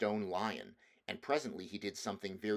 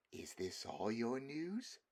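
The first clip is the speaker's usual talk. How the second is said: slow, soft, cold witch; cruel; 2 semitones higher, 6 dB quieter, slower than usual. Acted. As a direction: let the smile into the menace. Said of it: "slow, cruel smile".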